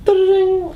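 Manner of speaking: high sung ta-da-ding; triumphant little fanfare; ta-da jingle. Pretend. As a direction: chime it like a fanfare. Sound effect flourish.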